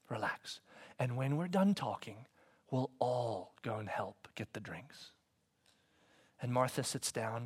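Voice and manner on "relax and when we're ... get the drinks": gentle hush. Acted. Jesus gently reassures Martha.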